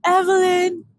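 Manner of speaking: a hint of a cocky, self-assured dude; smug friend. Imitating her friend calling Evelyn, mildly smug. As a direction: say her name like a confident guy. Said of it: "this is how he talks when he says it too".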